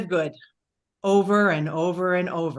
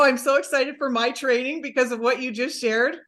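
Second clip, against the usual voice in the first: usual speaking voice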